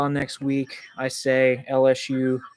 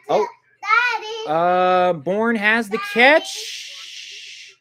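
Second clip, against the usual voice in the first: drawn-out, slow, higher excited play call; 9 semitones higher, 4 dB louder, much slower than usual. Real, not acted. Fan calling a big catch, stretched excited call.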